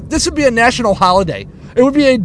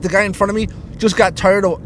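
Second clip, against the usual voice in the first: normal talking voice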